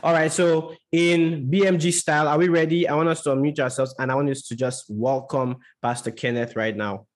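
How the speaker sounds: normal talking voice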